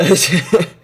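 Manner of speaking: bright burst of laughter; loud sudden laugh; short laugh. Real, not acted. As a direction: laugh it off quickly. Laughs at own confusion.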